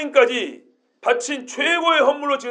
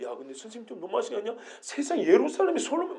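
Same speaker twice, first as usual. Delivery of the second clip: fast, very soft complaining; hushed fast grumble; grumbling aside; 9 dB quieter than usual. Retold or imitated. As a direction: gossip under your breath quickly. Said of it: "막 이렇게 막 얘기했을 거 아니에요".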